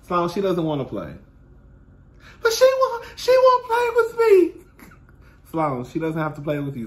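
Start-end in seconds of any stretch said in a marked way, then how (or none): 2.4-4.6 put-on high, slow whine of a little girl; whiny child voice. The little niece whining to her aunt, high and slow.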